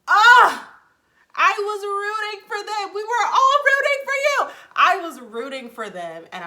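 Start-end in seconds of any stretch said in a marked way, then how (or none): 0.1-0.7 loud, high cry of frustration; high, full-voiced wail of dismay; exasperated scream. Outburst of frustrated fan. 1.4-2.9 high, loud outburst of dismay; hammed-up, high-pitched frustrated yell; exasperated shout. Mock outburst over failed couple. 2.9-4.5 very high, full-volume scolding yell; hammed-up, high screaming reproach; outraged shout. Quoted outburst at failed couple.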